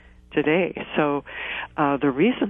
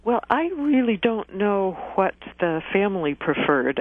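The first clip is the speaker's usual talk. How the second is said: ordinary talk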